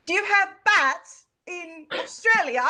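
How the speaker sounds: high, amateur put-on Australian accent; attempted Australian accent. Shown as a demonstration. Host trying out accents on guest, bad Australian accent. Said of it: "I think my Australian accent's gonna sound".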